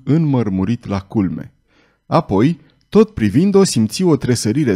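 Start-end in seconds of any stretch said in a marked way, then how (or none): none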